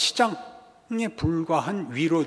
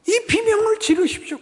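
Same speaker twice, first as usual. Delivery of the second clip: urging outcry; 12 semitones higher, 3 dB louder than usual. Real, not acted. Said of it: "urging the congregation to cry out".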